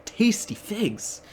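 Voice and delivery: mock-greedy Jesus, high and savoring; hammed-up craving, with hungry hums. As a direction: ham up a starving Jesus drooling over figs. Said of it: "And he says".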